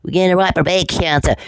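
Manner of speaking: high-pitched scornful mimicry; mocking the plotters. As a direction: parrot their threat in a high put-on voice. Mimics the men's plan again.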